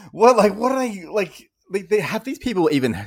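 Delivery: high, loud, incredulous rant; a high-pitched "are you serious" tirade; exasperated disbelief. Real, not acted. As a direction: sputter in amused outrage. Exasperated rant about fake accents.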